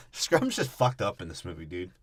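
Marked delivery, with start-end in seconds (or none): none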